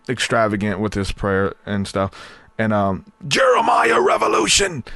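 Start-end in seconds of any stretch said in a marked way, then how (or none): 3.3-4.8 high shouted preacher cry; Lou Engle impression. Lou Engle impression proclaiming at a rally, high shout. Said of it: "Lou Engle".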